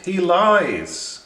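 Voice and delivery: high, loud urgent warning. A narrator's reading. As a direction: cry out the warning. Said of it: "the seagulls warned".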